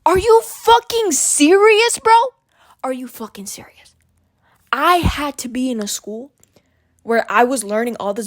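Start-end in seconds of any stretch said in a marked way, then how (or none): 0.0-2.1 high, slow incredulous yell; outraged. Real, not acted. Venting to viewers about school, slow outraged yell. 2.8-3.9 whispered disbelief. Real, not acted. Repeating the complaint to viewers, whispered in disbelief. Repeats the outburst under breath.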